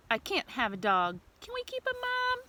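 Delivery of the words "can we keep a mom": put-on high, pleading little kid voice; high small-child voice, softer; child's plea. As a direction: beg like a tiny child wanting a pet. Baby worms voiced in picture.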